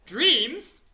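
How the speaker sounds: loud, folksy friendly giant. A narrator's reading. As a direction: play the big kind giant.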